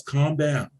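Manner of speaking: ordinary talk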